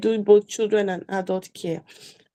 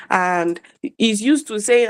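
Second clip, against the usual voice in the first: ordinary talk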